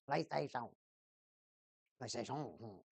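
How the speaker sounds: soft, low French-style PlayStation; mock French accent. Streamer showing how they say it, mock French. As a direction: murmur PlayStation with a put-on French ending. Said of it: "They all say it French".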